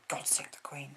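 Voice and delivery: breathy patriotic whisper. Real, not acted. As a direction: whisper it like a secret vow.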